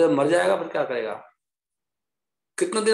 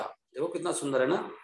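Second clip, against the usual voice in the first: ordinary delivery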